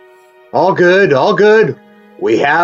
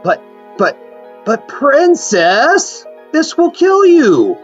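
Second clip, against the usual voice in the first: alarmed pleading; slower than usual. Acted. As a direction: voice leaping high in alarm.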